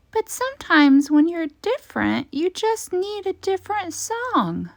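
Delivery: high, gentle little cricket voice; wise cricket. A narrator's reading. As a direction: soothe him like a kind insect. Cricket character voice.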